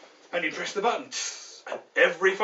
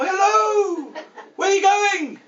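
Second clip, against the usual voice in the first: very high, loud ferry-to-ferry call; 13 semitones higher, 8 dB louder, much slower than usual. Acted. Passengers greeting strangers on another ferry, calling out. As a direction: holler it across to the other boat.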